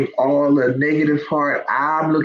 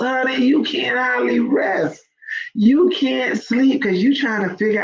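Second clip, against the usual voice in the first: fired up; 8 semitones higher than usual. Real, not acted. Preaching about being broke.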